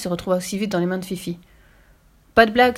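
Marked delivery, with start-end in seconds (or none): 2.3-2.8 louder, higher angry outburst; furious cry. A narrator's reading. Burglar warning the girl off the suitcase, furious. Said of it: "s'écria Carlson l'éclair furieux".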